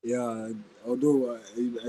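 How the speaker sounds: ordinary talk, nothing marked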